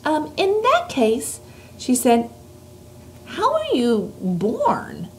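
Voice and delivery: put-on a light, lifted girl's voice; little girl voice. Reads Sophie's lines.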